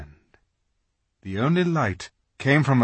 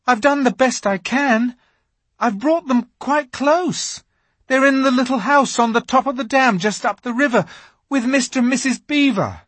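put-on high, hurried boy's voice; nervous young Edmund; pleading Edmund; 8 dB louder than usual. A narrator's reading. Edmund defends himself to witch.

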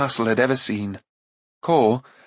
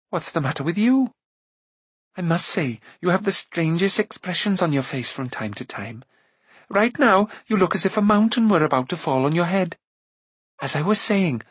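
lightly voiced chatty girl, higher; chiding Eilonwy; 6 semitones higher than usual. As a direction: chide him in a light girlish voice. Voices Eilonwy scolding Taran.

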